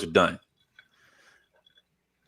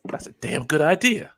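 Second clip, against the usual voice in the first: Bobby impression; 8 semitones higher, 6 dB louder than usual. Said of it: "Bobby's like".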